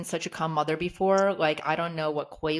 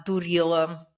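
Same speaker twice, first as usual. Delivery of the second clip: teasing Russian word; 4 dB louder than usual. Retold or imitated. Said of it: "like calling somebody a little dummy".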